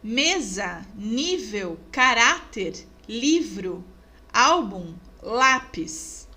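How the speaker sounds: mesa, nível, caráter, livro, álbum, lápis, each punched on the second-last syllable; stress on penultimate syllable. Shown as a demonstration. Teacher listing paroxítonas, penultimate stress in mesa through lápis. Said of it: "Vamos para as paroxítonas?".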